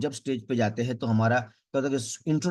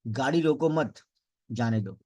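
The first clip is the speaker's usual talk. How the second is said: attach मत to रोको, then break; pause after मत. Shown as a demonstration. Speech coach flipping the meaning, pause after मत. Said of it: "यही sentence दोबारा बोलता हूँ".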